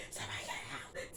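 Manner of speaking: panicked breathy whisper; frantic whisper. Retold or imitated. Retelling Wanda in panic, frantic whisper. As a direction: hiss it in a panic. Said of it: "She freaking out".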